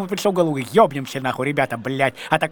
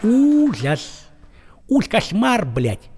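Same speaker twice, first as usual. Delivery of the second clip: usual speaking voice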